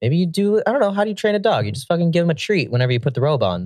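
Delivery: ordinary delivery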